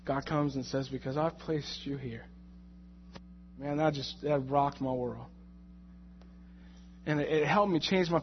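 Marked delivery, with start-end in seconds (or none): 0.9-2.2 calm voice of God. Acted. God answers again.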